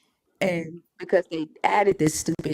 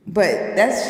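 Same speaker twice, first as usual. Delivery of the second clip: normal talking voice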